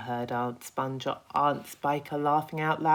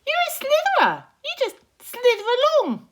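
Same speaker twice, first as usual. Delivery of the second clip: much higher, louder cocky insect voice; a boastful little bug, pitched way up; Centipede voice; 12 dB louder, faster than usual. Acted. Centipede taunting.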